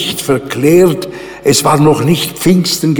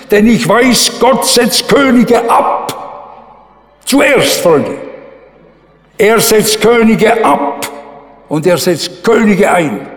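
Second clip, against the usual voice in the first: higher, building preacher's proclamation; rising proclamation; 5 semitones higher, slower than usual. Acted. Preacher to his congregation, proclaiming with rising fervour. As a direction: proclaim it, voice climbing on Könige.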